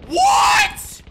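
sudden high cry of disbelief; shocked shout. Real, not acted. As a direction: yelp it out in shock. Reacts to sudden in-game event.

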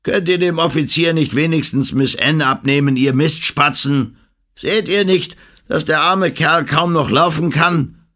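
high, loud scolding; gruff scolding. A narrator's reading. Lumpy scolding the sailors for not helping, loud and high. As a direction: tell the idlers off roughly. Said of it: "fuhr er die Leute an".

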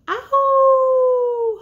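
long, high, loud howl; wolf howl. Pretend. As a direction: throw a big howl at the moon. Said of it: "He's howling at the moon".

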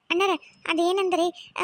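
ordinary delivery

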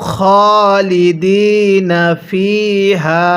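slow, drawn-out recitation of খালিদিনা with long vowels; Quran recitation. Shown as a demonstration. Teacher modelling খালিদিনা for viewers to repeat, slow recitation. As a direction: recite খালিদিনা slowly, stretching each vowel. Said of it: "পড়ুন".

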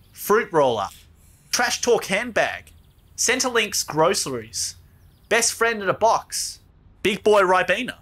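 reeling off nicknames. Acted. Listing names for the wine bag to a puzzled mate, slow proclaiming.

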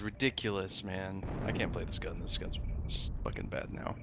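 whispered complaint under the breath; muttered grumble. Real, not acted. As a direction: grumble to yourself about the gun. Grumbling about bad gun.

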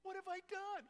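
begging David. Acted. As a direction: plead harder, almost a wail. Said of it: "he is begging to fight".

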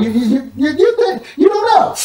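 stammering up into laughter; high giggling stammer; laughing. Real, not acted.

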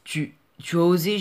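stammering, choked indignation; a mild touch of a hoarse, stammering groan. Acted. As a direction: choke on your outrage. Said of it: "gémit le fauconnier d'une voix rauque".

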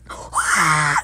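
big gasp of excitement; shocked gasp. Real, not acted. Gamer seeing the new event, excited gasp. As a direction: suck in air in shock.

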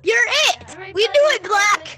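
real triumphant yell; victory shout. Real, not acted. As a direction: whoop it up after the win. Celebrating the winning vote.

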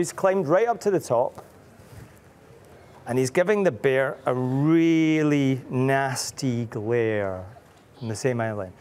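usual speaking voice

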